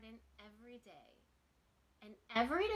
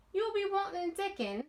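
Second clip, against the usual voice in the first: much higher and brighter maid's voice; higher, helpful servant-girl voice; Martha's voice; 9 semitones higher, 6 dB louder than usual. Acted. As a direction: answer like a cheerful housemaid who knows the answer. Martha answers Mary.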